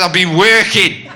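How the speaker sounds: put-on slow, proud drunken reply; drunk smugness. Drunk Harry answering his angry girlfriend, slow smug. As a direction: say it slowly, pleased with himself.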